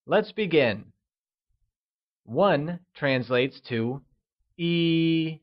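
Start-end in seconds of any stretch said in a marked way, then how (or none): 4.6-5.4 E held high and level in Mandarin. Shown as a demonstration. Teacher modelling E with a high level tone for learners. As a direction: sing E out on one steady high pitch. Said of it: "pronounced with a high level tone".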